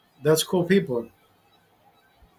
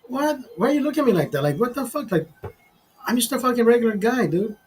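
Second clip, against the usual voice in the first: higher, hammed-up what's-wrong-with-you; mock-bewildered greeting; 7 semitones higher than usual. Greeting a staring fan, higher mock-puzzled bro voice. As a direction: play it like a puzzled buddy, lightly. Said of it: "And I'm like".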